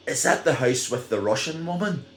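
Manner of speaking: high, curious question; eager kid voice. Retold or imitated. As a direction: play a nosy wee kid. Kids asking about neighbour.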